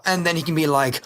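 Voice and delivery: ordinary talk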